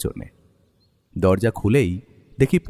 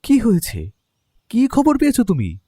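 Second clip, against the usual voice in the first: anxious questions; 8 semitones higher than usual. Acted.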